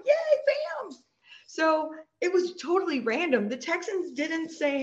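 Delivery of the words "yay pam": high squealed cheer. Retold or imitated. Friends cheering Pam as she announces the pick, high squeal. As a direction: cheer your best friend on.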